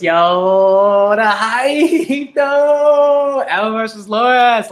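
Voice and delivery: loud, high hype with a laugh; excited fan yelling at the page; hyped up. Real, not acted. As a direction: shout it like the fight just got real. Hyped reader shouting at manga.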